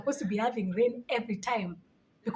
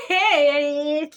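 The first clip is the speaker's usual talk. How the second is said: laughing exclamation; 4 semitones higher, 7 dB louder than usual. Real, not acted. Laughing in disbelief.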